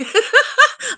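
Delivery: a sudden, high-pitched squeal of a laugh; burst of high laughter. Real, not acted. Laughing hard at the joke about Richard, high squealing laugh. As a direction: cracks up at her own joke.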